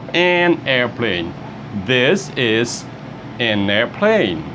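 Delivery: slow, careful teacher model; slow model phrase. Shown as a demonstration. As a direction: drag each word out clearly for learners. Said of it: "model phrase for class".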